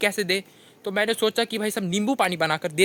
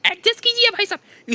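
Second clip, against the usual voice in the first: much higher, sing-song mock of the chef; 12 semitones higher than usual. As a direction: parrot the YouTube chef in a squeaky high voice. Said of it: "At the end में जाकर बोल रहे हैं भाई साहब कि". (Chef की ऊँची, चिढ़ाती हुई नकल)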